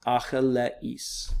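"Achilles" as slow Greek a-hi-le-is; "Achilles" drawn out syllable by syllable for learners; syllables put together. Shown as a demonstration. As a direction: sound out "Achilles" slowly in Greek, a-hi-le-is. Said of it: "you put those together".

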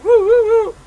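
high loud siren wail; siren imitation. Imitating the police car behind him, loud high siren. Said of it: "Police like".

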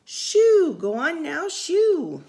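high, loud shoo; shooing away. Acted. Music men shooing the wet dog, high and loud. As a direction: chase him off loudly, high-pitched.

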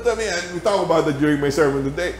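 ordinary talk, nothing marked

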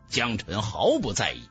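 ordinary talk, nothing marked

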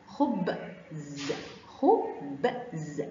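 خبز very slow and low, split into khu, b, zzz; sounding out. Shown as a demonstration. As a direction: break خبز into its separate sounds. Teacher breaks word into sounds.

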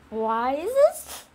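loud, slow, rising wail; wailing lament. Pretend. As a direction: drag it out in loud protest. Wailing over brother's death in story.

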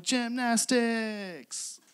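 imitating forced fun, long and high; trying to be fun. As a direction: stretch the word out in playful singsong. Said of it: "trying to be fun".

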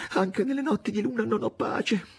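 high, anguished lament to the moon; anguished murmur. A narrator's reading. Master turning to the moon, high anguished lament. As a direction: moan it out in dread. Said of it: "cominciò a mormorare sussultando".

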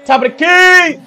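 high, loud shout at the game; sudden real holler; excited call-out. Real, not acted. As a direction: yell the play out excitedly. Calling out during live game.

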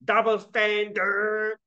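high "Double standard" with a drawn-out "dard"; slow mock mispronunciation, stretching the end of "standard"; funny wrong stress. Shown as a demonstration. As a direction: overdo the final syllable of "Double standard". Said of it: "this is very funny".

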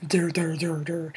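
low, dopey mock drone; dull, dumb-sounding taunt; mocking dimwit noise. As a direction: imitate someone slow on the uptake. Mocks classmates as stupid.

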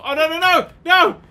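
high, loud volley of refusal; alarmed shouting. Real, not acted. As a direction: cry out in sudden panic. Runs into the world's edge.

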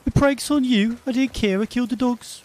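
high-pitched mock accent impression; Faye's accent. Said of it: "read it in this accent, it's exactly how she sounds".

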